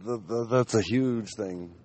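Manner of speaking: high, loud woo easing into an eager stammer; excited manager. Retold or imitated. Store manager reacting to a big donation, excited. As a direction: imitate a thrilled, stammering manager. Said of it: "was all fucking excited".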